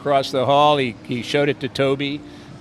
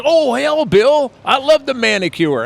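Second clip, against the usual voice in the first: imitating Tobe Hooper; 11 semitones higher, 6 dB louder than usual. Tobe Hooper greets Bill on the phone, high loud imitation. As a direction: play him big and delighted.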